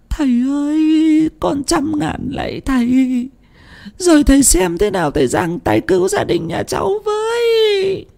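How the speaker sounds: very high sobbing cry for help; weeping plea. A narrator's reading. Thắm tearfully begs the seer, high sobbing wail. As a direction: beg through tears, breaking into a wail. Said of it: "nước mắt ngắn nước mắt dài".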